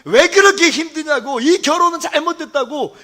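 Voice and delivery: a preacher reliving a man's shouting; retold yelling at God. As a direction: cry out loud to God in despair, as told in a story. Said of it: "하나님께 고래고래 소리를 질렀대요".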